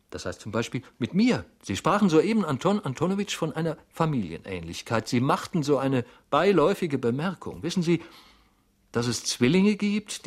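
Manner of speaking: high, urgent, tumbling explanation; worked-up clerk, voice pitched well up; agitated Goljetkin, insistent. A narrator's reading. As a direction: drive the point home anxiously. Flustered clerk insists on likeness.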